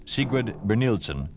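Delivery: ordinary talk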